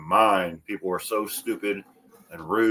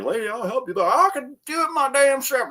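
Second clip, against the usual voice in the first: high, put-on voice of a huffy stranger; hammed-up annoyed boater; mock grumpy guy. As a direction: mimic him getting indignant. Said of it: "he was like".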